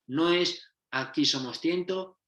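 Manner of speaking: normal talking voice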